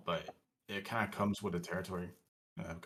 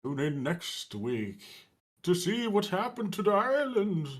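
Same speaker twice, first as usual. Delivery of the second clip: announcer voice; 9 semitones higher, 5 dB louder than usual. Pretend. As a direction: sign off like a TV cliffhanger. Mock show sign-off, rising high.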